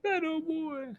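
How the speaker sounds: high mock bird voice; high squeaky voice.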